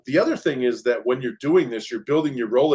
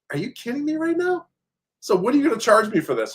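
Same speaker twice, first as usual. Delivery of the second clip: high-pitched retold disbelief, half mocking; imitating his own stunned reply to the contractor; incredulous; 11 semitones higher than usual. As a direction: toss it up high in mock outrage.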